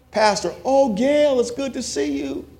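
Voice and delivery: high, gushing mock greeting; gushing church lady. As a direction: ham up a high sugary church welcome. Aunt greeting congregation.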